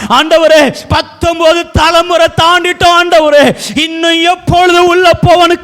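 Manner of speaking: preacher's high, pleading cry to God; impassioned wail in words, no sobbing. Acted. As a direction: cry out to the Lord in one high run. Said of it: "கதறுகிறா".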